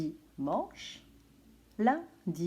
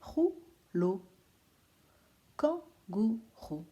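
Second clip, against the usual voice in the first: kangourou drawn out slowly, syllable by syllable; much slower than usual. Shown as a demonstration. Teacher to pupils, spelling out syllables slowly. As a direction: stretch each syllable of kangourou. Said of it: "on entend la syllabe roux".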